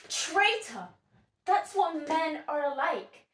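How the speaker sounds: theatrical indignation, hammered out; indignant denunciation. Acted. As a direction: spit the accusation at all men. Sylvia denounces a two-faced husband.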